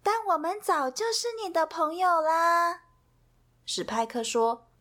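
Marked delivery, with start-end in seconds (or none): 0.0-2.8 high, kind Millie voice; reassuring Millie. Acted. Millie reassures sad Pip, high and kind. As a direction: soothe a sad friend. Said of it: "米莉说".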